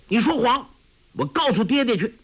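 put-on high, angry child's cry; furious little boy near tears. A narrator's reading. Tolui rages at the lying bully, angry high voice. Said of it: "怒道".

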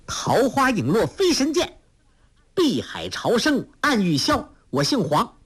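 high, clear ringing proclamation; a girl proudly announcing her lineage. A narrator's reading. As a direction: declaim it proudly. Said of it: "黄蓉朗声说".

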